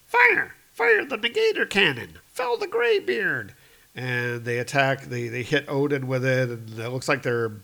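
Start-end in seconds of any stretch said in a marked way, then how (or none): 0.1-3.5 trolls yelling. Acted. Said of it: "the trolls are like".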